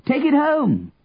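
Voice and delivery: high, sustained call; high-pitched calling out; carrying shout. A narrator's reading. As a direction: send it far along the street. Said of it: "His voice carried far along the street".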